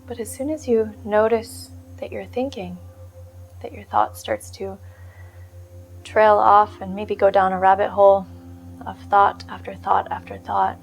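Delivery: soft, unhurried meditation voice with long pauses; guided meditation. Real, not acted. Meditation teacher settling listeners onto the breath, calm guided-meditation voice. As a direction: gently guide them into stillness, leaving space between phrases.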